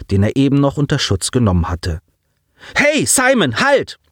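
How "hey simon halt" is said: hoch hinterherrufen; laut nachrufen, damit er stehen bleibt; nachrufend. A narrator's reading. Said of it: "rief Ensign Coulson ihm nach". (Ensign ruft Simon warnend nach)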